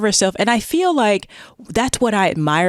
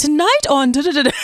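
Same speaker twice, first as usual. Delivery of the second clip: higher, laughing newscaster impression; 8 semitones higher than usual. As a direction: mimic a TV anchor's intro, pitched up with a laugh. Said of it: "they go into that newscaster voice".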